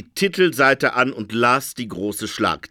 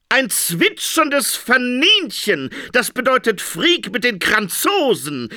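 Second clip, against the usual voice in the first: shouting; 9 semitones higher than usual. A narrator's reading. Interpreter shouts the prophecy at Phoenix. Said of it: "schrie der Traumdeuter".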